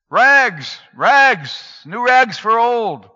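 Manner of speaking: high, loud peddler's call, drawn out; street cry. Acted. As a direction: call the wares down the alley. Ragman calling his wares.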